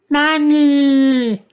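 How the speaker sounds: put-on very high, loud little-boy cry; toddler shout. A narrator's reading. George dressed as a mummy, high shout. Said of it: "shouted George".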